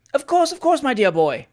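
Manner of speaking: high, effusive uncle; jovial reassurance from an old gentleman; hearty Uncle Tom. A narrator's reading. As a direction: agree eagerly, starting high and settling.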